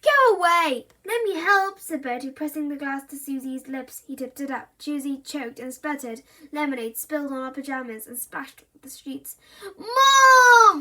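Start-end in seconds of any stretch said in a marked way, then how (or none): 0.0-1.8 high, loud pushy plea; squabbling. A narrator's reading. Siblings quarrel over lemonade. 9.8-10.8 loud, high wailing cry. A narrator's reading. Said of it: "wailed Susie".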